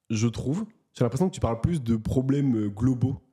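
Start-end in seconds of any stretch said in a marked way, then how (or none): none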